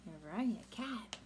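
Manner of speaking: plain reading voice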